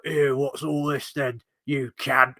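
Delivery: slow, put-on Cockney orc voice; Cockney Azog. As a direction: play the Pale Orc as a London geezer, drawn out. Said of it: "a Cockney Azog".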